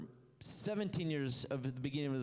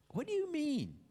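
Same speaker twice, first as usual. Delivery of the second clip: incredulous; 14 semitones higher than usual. Acted. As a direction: jump up in disbelief. Incredulous question voiced for listeners.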